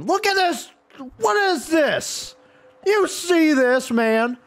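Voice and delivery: high-pitched incredulous outburst; exasperated ranting. Real, not acted. Gamer ranting at cars that won't park, exasperated. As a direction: rant at them in a high, straining voice.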